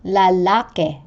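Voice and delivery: Lalaki with stress on the middle -la-; penultimate stress. Shown as a demonstration. Teacher showing penultimate stress, middle syllable stressed in Lalaki. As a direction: lean on the second syllable of Lalaki. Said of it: "given to the syllable before the last".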